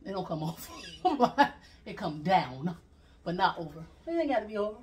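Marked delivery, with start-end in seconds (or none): none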